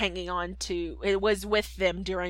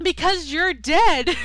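high, loud, slow hammed-up father; Geppetto insisting; 8 semitones higher, 9 dB louder, slower than usual. Geppetto insisting to Pinocchio he is dead, slow and loud. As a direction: drag it out like a stubborn old dad. Geppetto voiced again, laughing.

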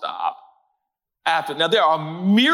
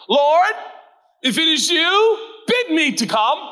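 high, theatrical call to the Lord; hammed-up Shakespearean plea, pitched up; mock Romeo and Juliet; 9 semitones higher than usual. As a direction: declaim it like a lovestruck stage hero. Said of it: "just turn into Romeo and Juliet".

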